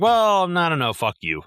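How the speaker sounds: mock indignant retort at a mother who called the cops; mock defiance. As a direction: scoff at her like she deserves it. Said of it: "I'm like".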